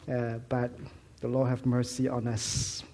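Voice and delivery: ordinary talk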